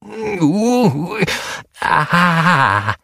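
high, wailing troll groan; eerie wailing troll moan; awful groan. A narrator's reading. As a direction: moan like something echoing in a cave. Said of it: "ein furchtbares Stöhnen".

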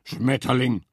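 a touch of a groan on Schmetterling. A narrator's reading. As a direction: moan the word Schmetterling in misery. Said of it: "stöhnte Wildschwein".

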